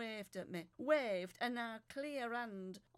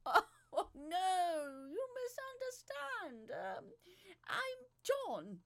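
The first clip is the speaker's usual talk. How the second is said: higher, slow pleading man's voice; pleading John; 8 semitones higher, 6 dB louder, much slower than usual. Acted. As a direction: plead innocence with a sob in it. John's voice in the story.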